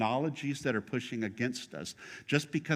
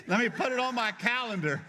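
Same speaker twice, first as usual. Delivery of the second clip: higher, laughing through a teasing quote; laughing mock line; 8 semitones higher than usual. Preacher teases binder carriers, laughing through the quote. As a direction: tease them while cracking up. Mocking binder-carrying church members.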